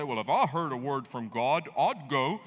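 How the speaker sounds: mock pious. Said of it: "get all high and mighty spiritual and say".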